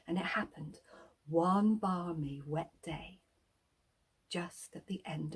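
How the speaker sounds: hushed, building suspense; suspense whisper. A narrator's reading. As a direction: whisper it like a secret.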